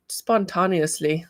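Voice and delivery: slow odd-accented "spontaneously", stretched "TAHN" in the middle; odd accidental accent. Real, not acted. Reading a viewer's comment aloud, slipping into an odd accent. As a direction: say "spontaneously" slowly with an accidental accent, "spon-TAH-nee-usly". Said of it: "I don't know what kind of accent that is".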